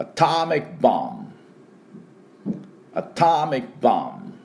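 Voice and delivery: アトミックボム with long stressed ター and drawn-out final ボム; repeat-after-me model. Shown as a demonstration. Teacher has listeners repeat after him, pronunciation model. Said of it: "では、私の後について発音しましょう".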